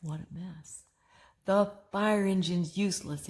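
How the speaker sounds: ordinary delivery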